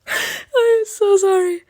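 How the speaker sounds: slow, high, half-sobbed apology; mock sobbing. Real, not acted. Player apologises to the wounded dragon, slow mock sob. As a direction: wail the apology, drawn out.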